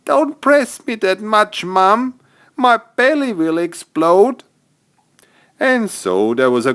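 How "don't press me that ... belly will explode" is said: put-on high little-boy voice, half-whining; Joey complaining. Joey hugged too tightly by his mum, high and whiny. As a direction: squeak it out like an overstuffed little boy.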